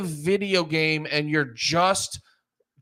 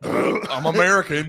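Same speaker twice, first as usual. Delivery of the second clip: mock American bluster; 3 semitones lower than usual. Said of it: "then I'm like".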